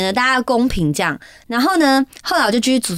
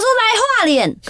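calling out; 10 semitones higher, slower than usual. Retold or imitated. Friends call her for the forfeit.

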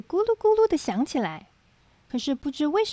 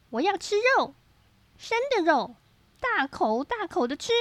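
hungry tiger; 5 semitones higher than usual. A narrator's reading. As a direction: play a greedy little tiger. Tiger character craves meat.

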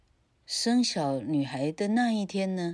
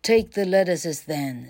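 loud commanding witch; 7 dB louder than usual. Acted. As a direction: bark it out like a witch. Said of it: "said the witch".

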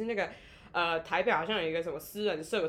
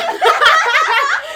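long high laugh, much louder; roaring laughter; 18 dB louder than usual. Real, not acted. Guest roars laughing about her deadly volleyball slap. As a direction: crack up completely.

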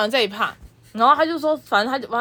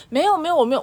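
polite denial; 8 semitones higher, faster than usual. Retold or imitated. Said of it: "我很客气".

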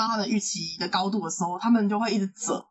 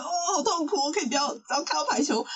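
mock anguish; 7 semitones higher than usual. Acts out dread of volleyball.

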